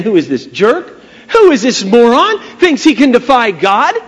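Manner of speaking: mildly acted indignant questioning. David scorns Goliath for defying God, mock indignation. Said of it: "he was indignant about the whole thing".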